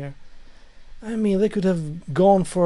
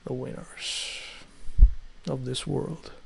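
hushed whisper; 5 semitones lower, 5 dB quieter, slower than usual. Real, not acted. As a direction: breathe it out softly. Whispered title idea.

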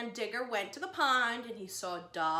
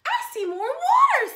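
higher, louder eager little animal; Digger's voice; 14 dB louder than usual. Acted. Digger spots more water, high eager little voice. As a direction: squeak it out like an excited small creature.

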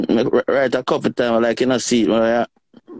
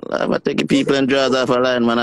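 ordinary talk

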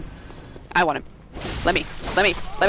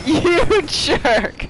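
high indignant cry; high, mock-outraged complaint; exasperated insult; 7 semitones higher, 4 dB louder than usual. Real, not acted. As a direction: scold the enemy, breaking into laughter. Scolding game enemy after scare.

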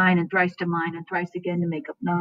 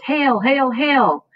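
ringing hail; 7 semitones higher, 4 dB louder, much slower than usual. Acted. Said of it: "The first witch says".

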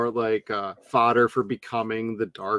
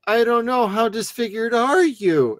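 mocking Julie; 8 semitones higher than usual. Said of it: "she's like".

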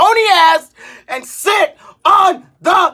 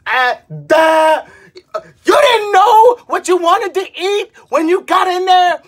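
higher, louder scornful outburst; mock exasperated yell; 5 semitones higher, 4 dB louder than usual. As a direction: yell the disbelief at them. Ranting back at the dithering customer.

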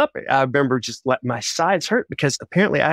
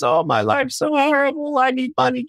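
whiny missionary; 13 semitones higher than usual. Retold or imitated. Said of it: "horrible stories of missionaries".

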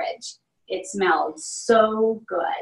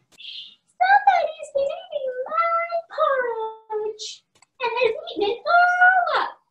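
put-on very high baby bear voice, rising to a cry; squeaky little bear wailing. A narrator's reading. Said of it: "cried Baby Bear in her little voice".

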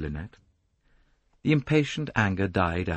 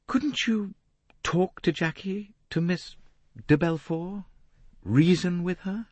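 slowly; 11 semitones higher than usual. Acted. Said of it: "Lynette said slowly".

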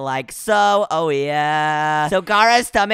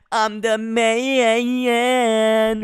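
very slow, sung-out brag; milk the boast, dragging out the last word; boastful hero; 3 semitones higher, much slower than usual. Acted. Replays his boast to Venom.

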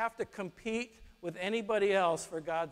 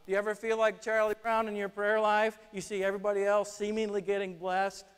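ordinary talk

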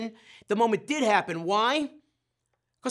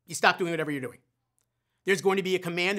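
normal talking voice

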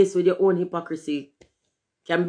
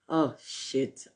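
usual speaking voice

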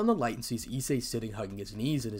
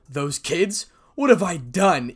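louder, higher cry of guilt; rising, horrified self-reproach; anguished outburst; 5 semitones higher, 8 dB louder, slower than usual. A narrator's reading. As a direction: cry out in horror at yourself. Punches wall in horrified guilt.

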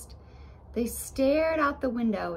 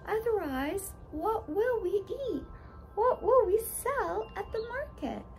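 put-on much higher, worried girl voice; anxious little girl; 11 semitones higher than usual. Blueberry Muffin to friends, higher worried girl. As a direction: fret about what they will eat.

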